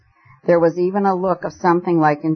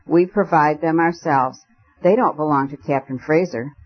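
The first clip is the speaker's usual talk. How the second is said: plain reading voice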